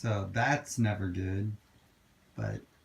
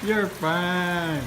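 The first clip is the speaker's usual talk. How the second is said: higher sing-song "You're fine." cooed to the dog; higher reassuring coo; 8 semitones higher than usual. Real, not acted.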